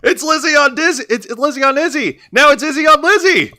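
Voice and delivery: high, excited announcer yell; hammy commentator shouting; mock shouting. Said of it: "He keeps shouting".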